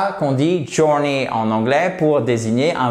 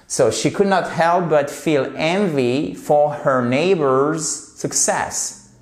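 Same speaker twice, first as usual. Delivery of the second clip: slow, careful English model sentence; model reading; 2 semitones higher, slower than usual. Shown as a demonstration. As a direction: repeat it slowly and clearly for the class. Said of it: "let me repeat that sentence for you". (le prof relit la phrase lentement aux élèves)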